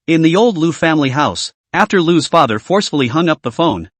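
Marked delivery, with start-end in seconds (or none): none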